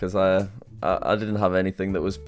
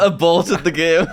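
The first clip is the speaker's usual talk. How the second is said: laughing; 10 semitones higher, 3 dB louder, slower than usual. Real, not acted. Teasing friend's quick exit.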